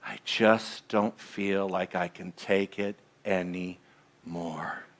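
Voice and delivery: soft, low, slow exhausted sigh of a voice; weary despair. Retold or imitated. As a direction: give up, drained. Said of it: "when you're going".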